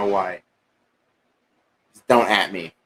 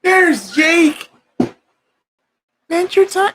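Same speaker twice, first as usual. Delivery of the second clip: high, loud excited greeting; excited shout; 4 dB louder than usual. Real, not acted. Host shouts out the theme as his friend arrives, high and loud. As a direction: yell it up high when the friend shows up.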